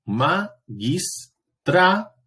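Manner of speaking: "magistratura" split slowly into syllables, a pause after each; sílaba por sílaba. Shown as a demonstration. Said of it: "yo separo". ("magistratura" separado en sílabas, lento y con pausas)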